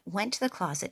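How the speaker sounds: ordinary delivery, nothing marked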